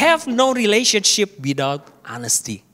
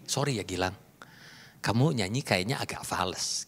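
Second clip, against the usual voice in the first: very soft, low, near-whispered apology; polite; 9 semitones lower, 11 dB quieter than usual. Shown as a demonstration. Speaker to Gilang about his off-key singing, hushed and polite. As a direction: break bad news gently, hushed and polite. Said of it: "ngomongnya politely".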